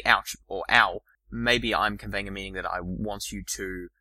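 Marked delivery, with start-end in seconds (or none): 0.0-0.3 loud pained ouch; a louder ouch of pain. Shown as a demonstration. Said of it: "Like if I say". 0.7-1.0 loud pained ow; a louder ow of pain. Shown as a demonstration. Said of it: "Like if I say".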